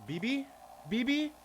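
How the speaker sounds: squeaky-high questioning call; high-pitched calling out. Pretend. Calls out to game character.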